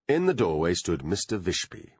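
ordinary delivery

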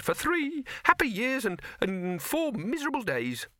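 high, squeaky little rabbit voice; a high, nervous talking rabbit. Acted. Rabbit continuing.

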